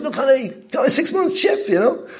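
bursting with pride over his six-month chip; proud excitement. Retold or imitated. Husband proudly shows his chip.

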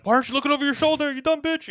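high, rapid, loud heckle; mock exasperated yell. Horror fan yelling at the character walking the dog, mock exasperated. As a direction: yell it like a fed-up horror viewer.

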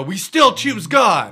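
loud vow. Retold or imitated. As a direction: proclaim it slowly and loudly. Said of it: "he wanted them to go".

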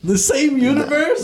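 high, slow disbelieving question; can't believe what he just heard; incredulous. Real, not acted. Friend in disbelief at the Star Wars mix-up, high incredulous question. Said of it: "No, come on, bro".

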